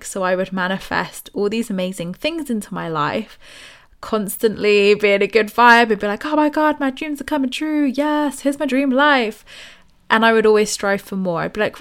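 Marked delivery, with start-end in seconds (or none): 6.2-9.4 high, gushing delight at dreams coming true; gushing excitement. Retold or imitated. Past self gushing over her dream life, high and thrilled. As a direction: squeal it like your dreams just landed.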